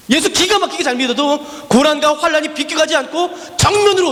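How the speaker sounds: full-force preaching cry; preacher's shout. Acted. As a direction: shout the climax of the refrain. Sermon refrain climax, shouted.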